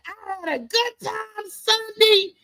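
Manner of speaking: playful mock delight on Monday morning; imitating a happy coworker. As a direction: gush like a coworker back from a great show. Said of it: "you'll be saying".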